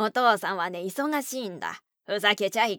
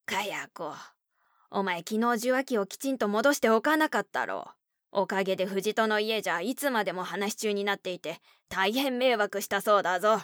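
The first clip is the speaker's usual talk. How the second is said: louder, complaining father voice; grumbling father; 4 dB louder than usual. Acted. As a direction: scold her with annoyance. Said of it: "文句だけ言って".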